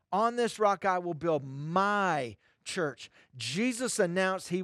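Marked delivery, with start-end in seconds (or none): 0.1-3.1 slow quote, landing heavily on a stretched "my"; stress on MY. Shown as a demonstration. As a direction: hold "my" long before "church". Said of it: "He placed emphasis on the word my".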